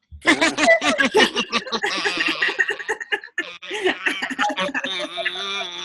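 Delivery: long high cackling laugh; witch laugh. Pretend. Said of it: "witch laugh".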